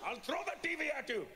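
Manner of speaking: high, outraged mock yell; hammed-up parental explosion; furious dad. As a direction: act out a father threatening his kid. Dad erupts at being shushed.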